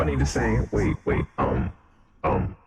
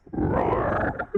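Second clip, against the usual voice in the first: monster roar. Pretend. Filtered voice plays monster.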